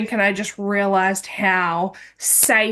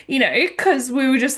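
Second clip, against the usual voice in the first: ordinary talk, nothing marked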